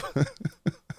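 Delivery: soft unvoiced laugh; quick airy chuckle; breathy laugh. Real, not acted. Laugh after singing jingle.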